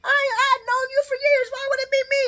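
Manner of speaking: very high-pitched, squealing mock protest; Brick. Vlogger mimicking Brick denying he is the rat, high-pitched. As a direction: exaggerate a shrill, panicked denial. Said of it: "Did y'all hear how high-pitched Brick's voice?".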